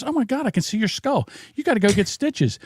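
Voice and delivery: high, alarmed woman's voice; shocked neighbor lady. Retold or imitated. Neighbor seeing a boy's split head, high alarmed voice. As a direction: mimic her horror at the skull. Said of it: "she goes".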